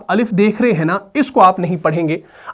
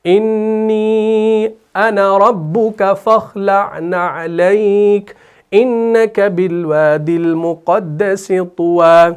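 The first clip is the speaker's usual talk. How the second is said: very slow, stretched tilawat; Quran recitation; much slower than usual. Shown as a demonstration. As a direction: draw out every vowel of the verse. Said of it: "इस तरीके से आपने याद रखना है".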